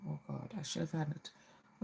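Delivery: ordinary talk, nothing marked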